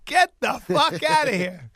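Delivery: loud, high, drawn-out disbelief; retold yell of comic shock, stretched slowly; shocked outburst. Son reacting to father's confession, loud shocked outburst.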